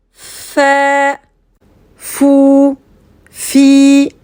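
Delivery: "fait", "fou", "fi" avec un F net soufflé entre les dents; sons FA FOU FI. Shown as a demonstration. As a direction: prononcer "fait, fou, fi" avec la lettre Fa arabe. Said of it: "ça va donner". (F arabe détaché, voyelle après)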